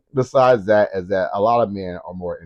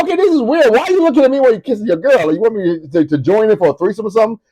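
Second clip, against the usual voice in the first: mock incredulous; 9 semitones higher, faster than usual. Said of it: "I'm like".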